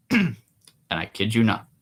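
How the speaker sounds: normal talking voice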